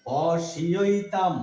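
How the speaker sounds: পশিঐতাম said very slowly as po-shi-oi-tam; careful pronunciation. Shown as a demonstration. Teacher showing how পশ্যৈতাম is read, slow syllables. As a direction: separate each sound of পশিঐতাম. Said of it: "এইটা হচ্ছে শুদ্ধ উচ্চারণ".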